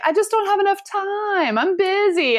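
high, whiny protest about being busy; whiny excuse. Retold or imitated. [name] tells her group she's too busy, whiny and high. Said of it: "And I was like".

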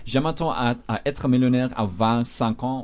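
"vingt-cinq" said as French vent-sank; French demo, slowly. Shown as a demonstration. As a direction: read the French slowly and clearly. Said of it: "I'll give you a sense".

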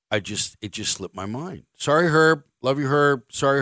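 ordinary talk

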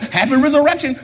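high-pitched cheerful call; lifted, upbeat greeting. Retold or imitated. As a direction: hail a passer-by from the car window. Greeting passer-by from car window.

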